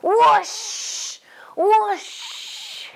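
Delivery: high, drawn-out water whoosh; sprinkler whoosh. Acted. Storyteller as the sprinkler soaking Bertie, whooshing.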